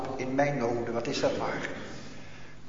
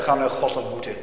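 ordinary talk

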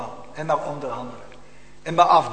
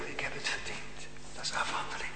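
hushed, voiceless whisper; 13 dB quieter than usual. Acted. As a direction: lean in and whisper it. Preacher drops to whisper.

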